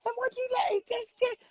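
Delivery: high, mocking old English mother; mom voice. Son mimics his mother scolding him, high mocking voice. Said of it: "And my mom's like".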